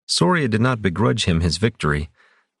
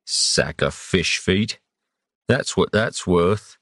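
slow grumbling sailor; slower than usual. A narrator's reading. Captain's grumbling thoughts.

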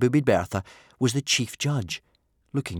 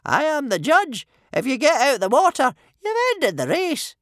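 very high, loud official voice; firm chief judge; 8 dB louder than usual. A narrator's reading. Judge telling chiefs the race is over, firm high voice. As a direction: lay down the law, high and loud.

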